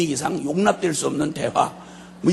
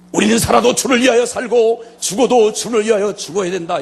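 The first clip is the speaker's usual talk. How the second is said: higher, louder pronouncement like a preacher at the table; a father suddenly declaring a Bible verse at dinner; mock solemn declaration; 6 semitones higher, 6 dB louder than usual. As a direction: proclaim the verse to the family.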